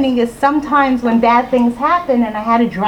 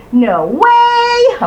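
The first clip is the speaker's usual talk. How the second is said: high, loud, drawn-out NO WAY; big playful punchline on "No way"; playful exclamation; 12 semitones higher, 6 dB louder than usual. Shown as a demonstration. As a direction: sing out WAY long and high. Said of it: "I changed it a little bit".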